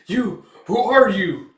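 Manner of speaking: shaken demand. Acted. Baron startled by the mark on his neck, shaken shout. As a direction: demand his name, rattled.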